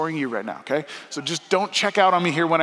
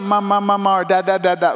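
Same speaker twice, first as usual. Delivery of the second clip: put-on baby babble; 3 semitones higher, 4 dB louder than usual. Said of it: "when your kids went".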